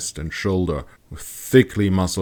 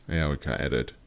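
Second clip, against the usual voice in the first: ordinary delivery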